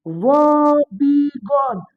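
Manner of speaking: वॉबीगॉन very slow, woe-bee-gone split apart; broken into syllables. Shown as a demonstration. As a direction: stretch वॉबीगॉन into its syllables, slowly. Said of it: "break down कर दो". (वॉबीगॉन को syllables में तोड़कर धीरे बोलो)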